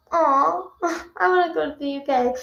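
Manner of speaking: a playful, mildly sad "aw" of wishing; touch of pouty disappointment; mock sad. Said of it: "which is very sad to me".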